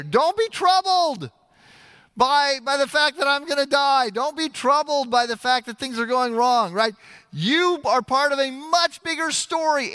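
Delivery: preacher voicing Jesus comforting his followers. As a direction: lift the voice as Jesus promising.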